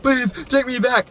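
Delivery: high, drawn-out begging; put-on pleading voice; mock pleading. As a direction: imitate a desperate ex begging.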